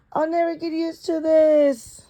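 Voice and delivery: put-on high, complaining kid; whiny kid voice.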